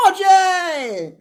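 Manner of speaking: high loud thrilled cry of a name; excited squeal. Retold or imitated. Hope spotting her brother, thrilled squeal. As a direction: shriek his name with joy. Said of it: "She was so, so".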